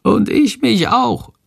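small creature, high and slow; high, slow character voice without any whisper; Shandor. A narrator's reading. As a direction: pitch it way up, drawn-out little voice. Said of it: "flüstert leise Shandor".

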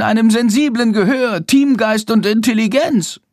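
proud little bat standing up for bats. A narrator's reading. Bat proclaims bats are no cat food, high and proud. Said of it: "mit erhobener Stimme".

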